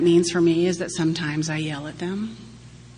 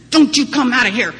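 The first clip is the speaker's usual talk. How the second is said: louder, higher scolding command; angry order; 6 semitones higher, 8 dB louder than usual. Retold or imitated. Exhausted mother ordering her toddler into his room, angry. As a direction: snap it at the child, fed up.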